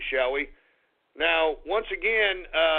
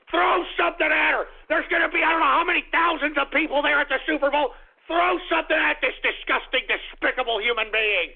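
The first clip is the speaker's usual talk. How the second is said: heated yelling; 9 semitones higher than usual. Acted. Host egging on Super Bowl fans, high heated yelling. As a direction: keep shouting the call to throw. Urging crowd to pelt singer.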